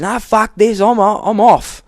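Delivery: loud, high imitated angry yell; storming off. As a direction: yell it as an imitated furious exit. Imitating coworker storming off in rage.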